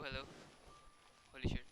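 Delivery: ordinary delivery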